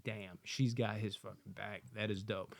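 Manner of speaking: quiet awe. Retold or imitated. Reviewer voicing his own reaction to her loyalty, hushed. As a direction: say it under your breath, impressed.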